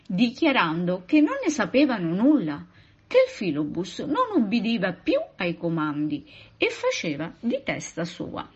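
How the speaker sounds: plain reading voice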